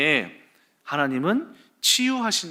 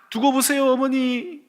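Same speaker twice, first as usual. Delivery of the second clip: 들뜬 다짐; 8 semitones higher than usual. Retold or imitated. 어머니께 자신 있게.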